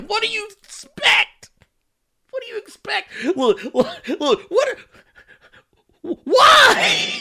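squeaky, very high pleading; hammed-up squeal breaking into laughter; exasperated mock outrage. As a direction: sputter in comic disbelief up to a shrieked why.

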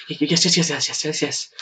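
rapid, high, stuttering run of yeses; giddy, eager agreement tumbling out; super excited, tripping over the word; almost giddy. Acted. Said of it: "She's almost giddy".

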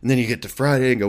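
ordinary talk, nothing marked

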